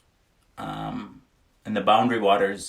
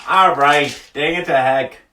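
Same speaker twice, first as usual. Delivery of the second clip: louder, higher mock outburst; mock exasperation; 5 semitones higher, 7 dB louder than usual. Real, not acted. Cursing mildly in mock exasperation, loud. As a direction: throw up your hands in fake frustration.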